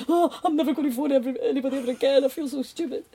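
high, loud, hammed-up mortification; mock despairing wail. Graduate vowing never to phone anyone again, mock wailing. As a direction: wail it in comic self-pity. Acts out her mortified vow afterwards.

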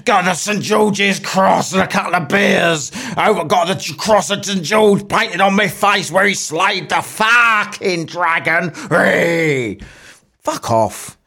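mock drunken bloke yelling his patriotism; drunk English patriot. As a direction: roar it like a hammered lad draped in the Saint George's cross. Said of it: "get really hammered down the pub, like proper".

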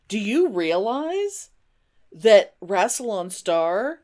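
dramatic reveal. Real, not acted.

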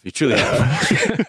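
slow, laughing through words. Real, not acted. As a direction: laugh it out fondly. Repeated, laughing.

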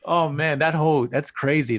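normal talking voice

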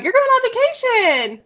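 high, bright excited call-out. Real, not acted. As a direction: burst out with excitement. Excited about friend's trip.

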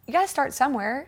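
high-pitched imitation of an instructor; lightly voiced coach's pep talk; spin instructor. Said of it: "the instructor busted me".

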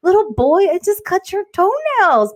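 high, slow teasing pity; high slow mock sympathy for a little boy. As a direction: coo at him like a sulking child. Teasing address to crying son.

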